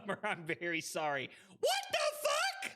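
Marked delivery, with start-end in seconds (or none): 1.6-2.7 very high yelp of disbelief; high-pitched startled yell; shocked outcry. Real, not acted. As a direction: squeal it in sudden alarm. Sudden shock in the game.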